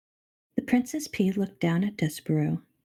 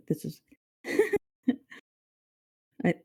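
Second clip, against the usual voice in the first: plain reading voice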